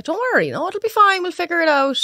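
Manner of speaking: high, sing-song soothing; smooth it over with forced cheer; bright reassurance. Retold or imitated. Printer soothes a panicking couple, high forced-cheerful reassurance.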